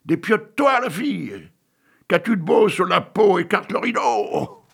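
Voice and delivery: high, loud jeering yell; crowd shouting. Acted. Crowd in the hall yelling at the girl, loud jeering. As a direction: heckle her like a greedy mob. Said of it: "Mais les voilà qui crient".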